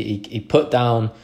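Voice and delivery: ordinary talk, nothing marked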